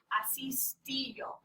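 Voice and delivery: slow unvoiced whisper of the verb; whispered model word. Shown as a demonstration. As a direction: breathe the word out slowly. Teacher modelling the verb form.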